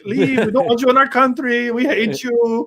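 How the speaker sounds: put-on high Icelandic accent, a laugh breaking in at the end; mock Icelandic accent. Quoting the bar scene in a mock Icelandic accent, laughing. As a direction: ham up a hostile Icelandic brush-off. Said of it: "the accent made those moments funnier".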